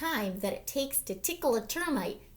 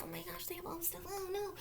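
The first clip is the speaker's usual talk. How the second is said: whisper; 4 semitones higher, 15 dB quieter, much faster than usual. A narrator's reading. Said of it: "whispered aside to the children".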